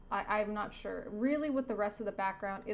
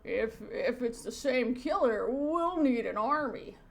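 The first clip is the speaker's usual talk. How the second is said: dismayed police chief; 2 semitones higher, 5 dB louder than usual. Acted. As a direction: play an alarmed cartoon chief. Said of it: "The chief says".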